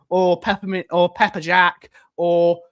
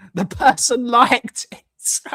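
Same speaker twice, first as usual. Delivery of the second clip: real laughter breaking into slow, higher words; cracking up; 5 semitones higher, 3 dB quieter, slower than usual. Real, not acted. Streamer amused by who liked the comment, cracking up. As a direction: dissolve into giggles while speaking.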